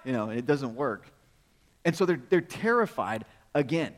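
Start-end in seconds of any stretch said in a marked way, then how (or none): none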